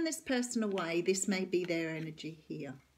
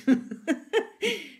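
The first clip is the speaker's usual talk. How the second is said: loud, high real laugh at her own slip; burst of laughter; 8 semitones higher, 9 dB louder than usual. Real, not acted. Laughing at her own tongue slip, loud laugh. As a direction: crack up laughing.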